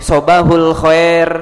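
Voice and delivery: "Sobahul khair" very slowly, "khair" said "khoer"; Arabic demo. Shown as a demonstration. Ustaz testing the congregation on the greeting, slowly. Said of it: "betul salah".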